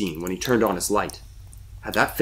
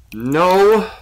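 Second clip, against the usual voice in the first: high, loud glum echo; short, glum; 9 semitones higher, 7 dB louder than usual. A narrator's reading. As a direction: answer curtly, higher and louder. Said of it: "it said shortly".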